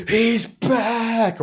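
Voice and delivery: very high, loud hammed-up cry; mock excited cry. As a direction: peak the imitated hype high and loud. Retold reaction, climax of three.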